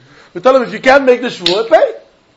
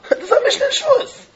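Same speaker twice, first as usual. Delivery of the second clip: laughing; 4 dB quieter than usual. Real, not acted. Laughs at the obvious answer.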